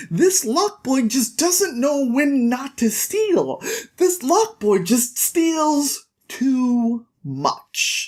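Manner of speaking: high, drawn-out mock lament; exasperated complaint. Thiefmaker complains to the priest about Locke, drawn-out exasperation. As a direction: stretch each word in despair.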